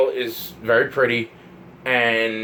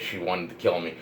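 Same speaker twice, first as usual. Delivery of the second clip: softer, lower, shaken disbelief; stunned hush; 6 semitones lower, 8 dB quieter than usual. Real, not acted. Repeating to viewers she wanted to kill him, hushed disbelief. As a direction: say it to yourself, quietly horrified.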